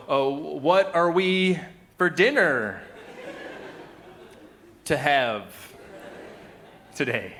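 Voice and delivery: halting, slow, high swoop then dropping; hammed-up beginner's question with long gaps; German-speaking toddler. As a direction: struggle out each chunk like a toddler learner. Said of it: "a German-speaking toddler".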